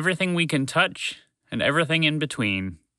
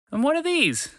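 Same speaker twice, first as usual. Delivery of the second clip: much higher, curious little voice; tiny creature voice; 12 semitones higher than usual. Acted. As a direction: squeak it out like a small critter. Wheezy asks curiously.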